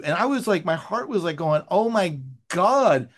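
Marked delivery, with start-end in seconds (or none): 1.7-3.1 retold dread. Writer fearing he has upset his friend, retold dread. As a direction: replay the sinking feeling, stretching the words. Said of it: "it's scaring me to death".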